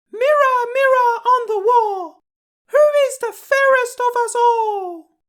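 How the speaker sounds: very high, loud put-on queen voice; a vain queen calling grandly to her mirror; proud queen.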